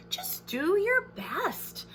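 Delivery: high, slow, encouraging friend voice; a kind friend cheering Pete on gently; Callie's voice. Acted. Friend Callie encouraging nervous Pete before the play, high and slow. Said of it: "his friend Callie said".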